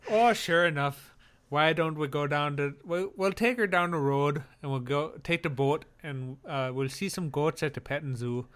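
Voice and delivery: folksy Minnesotan accent, lightly hammed-up; a light Minnesota accent, playful; mock Minnesotan accent. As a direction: ramble cozily about hot dish in a Minnesotan accent. Continuing the folksy accent bit.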